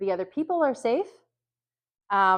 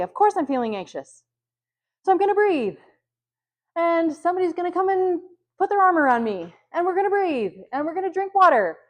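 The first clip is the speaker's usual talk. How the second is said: anxious self-talk; 8 semitones higher, 6 dB louder than usual. Shown as a demonstration. Modelling calm self-talk through anxiety.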